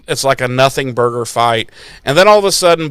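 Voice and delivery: ordinary talk, nothing marked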